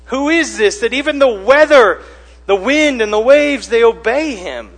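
high, loud disbelief; astonished disciples. Acted. Disciples asking each other who commands the storm, loud amazement. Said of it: "So what do they say?".

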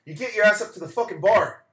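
pushy local yelling at the stranger to join the bar; mock shout. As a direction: bellow the order good-naturedly. Local repeats the order.

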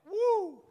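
high whooping cry; woo. Real, not acted. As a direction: burst out with a whoop. Preacher's own exclamation mid-sermon.

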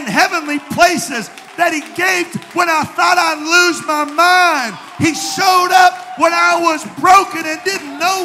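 rising, shouted preaching with long held phrases; full-force preaching. Acted. Pastor praising God to the congregation, shouting preaching. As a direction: preach it out loud to lift the church.

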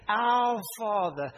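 "Our Father" drawn out like a church formula; distant formal address. Shown as a demonstration. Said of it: "Sometimes we say".

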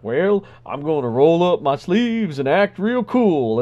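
slow, paused Obama voice; lightly voiced Obama, slow with pauses; Obama impression. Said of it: "do an Obama impression".

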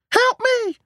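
very high, loud scream for help. A narrator's reading. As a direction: scream it out in panic. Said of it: "screamed Darcy".

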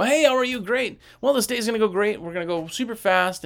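high, loud, hurried pep; hyped-up, rapid-fire hello; over-energetic greeting. Acted. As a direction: burst in with too much energy. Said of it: "you're on a different level".

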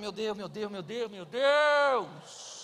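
slow, drawn-out exasperated groan; exasperated wife. Retold or imitated. Wife losing patience with slow husband, drawn out. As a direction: sigh it out, fed up with him. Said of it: "Ela fala".

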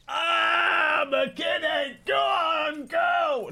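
high, loud soldiers' hooting shout; rowdy cheering. Acted. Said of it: "army dudes are like".

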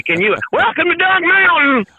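high, hammed-up welcome voice; mock greeter. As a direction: play the cheesy Dunk Mountain welcomer. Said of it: "Can you imagine going out there like".

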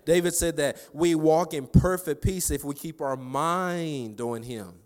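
high, forceful preaching; fervent, insistent sermon delivery. Real, not acted. Preaches at high intensity after story.

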